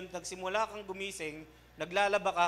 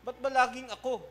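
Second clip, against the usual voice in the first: higher, louder mock whine; whiny child complaint; 7 semitones higher, 7 dB louder than usual. Preacher mimics a child complaining about always being told. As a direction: whine like a kid sick of chores.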